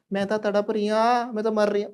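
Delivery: high-pitched mock lament; hammed-up wail of a woman in her death throes; dying woman. Soul describing her own dying, high mock wailing. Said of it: "और जब मर रही तो".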